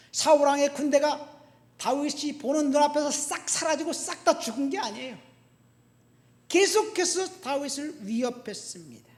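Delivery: loud, high preacher's delivery; forceful preaching. Real, not acted. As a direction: drive it home to the congregation.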